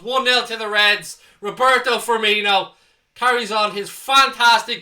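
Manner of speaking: loud, thrilled goal call; excited. Real, not acted. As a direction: bellow the goal news to the chat. Celebrating the goal live.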